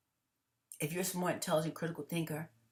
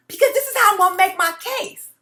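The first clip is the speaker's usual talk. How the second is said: laughing; 14 semitones higher, 15 dB louder than usual. Real, not acted. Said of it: "The reason why I'm laughing".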